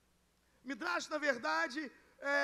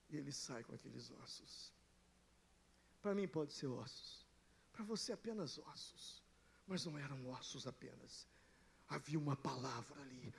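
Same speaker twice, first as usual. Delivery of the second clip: very low, soft pulpit aside; hushed preaching; 12 semitones lower, 15 dB quieter than usual. Real, not acted. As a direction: drop right down to a grave hush. (pregação em voz baixinha e grave)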